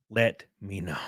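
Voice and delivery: a hushed, secretive whisper. Shown as a demonstration. Streamer whispers his sponsor ask to the viewers, hushed. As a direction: lean in and breathe it like a secret.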